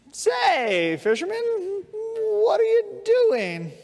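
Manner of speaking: high-pitched, incredulous question; puzzled passenger. Acted. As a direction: call out to the fisherman, voice climbing high. Passengers alarmed at drilling on boat.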